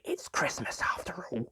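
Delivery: soft conspiratorial whisper; hushed playful whisper. Acted. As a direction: whisper it like a secret treat. Conspiratorial aside offering sweet.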